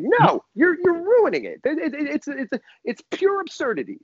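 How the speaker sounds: high, flustered protest; hammed-up indignant objection; mock anger. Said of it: "And then I'm mad at her".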